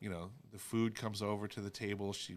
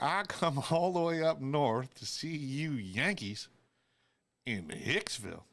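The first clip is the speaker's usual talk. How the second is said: louder, higher Southern drawl, slow and laughing; Southern grandfather; 6 semitones higher, 5 dB louder than usual. Retold or imitated. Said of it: "He'd be like".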